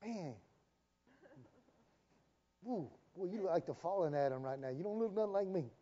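quiet, unimpressed God figure; God taken aback. Acted. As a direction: play God reacting under his voice, softly. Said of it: "And God's going".

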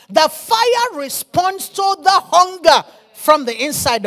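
loud, emphatic sermon delivery with punched key words; fiery preaching. Acted. Preacher urging the church to carry a fire, fiery preaching. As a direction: preach with urgent force.

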